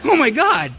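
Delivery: loud, high startled gasp of words; shocked. Retold or imitated. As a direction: blurt it in stunned disbelief. Shocked reaction to crowd's shout.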